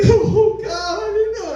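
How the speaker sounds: put-on high stunned girlfriend voice; high mock surprise. Girlfriend's shocked reply.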